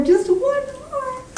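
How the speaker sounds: very high, slow, stretched-out words; playful drawn-out squeal. Real, not acted. Host teases last reader.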